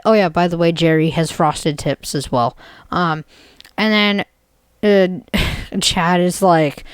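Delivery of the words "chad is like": ordinary delivery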